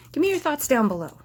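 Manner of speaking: high, loud, quick startled blurt; voice jumping up, startled. Real, not acted. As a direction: pitch shoots up as if about to knock something over. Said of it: "that's why my voice went up".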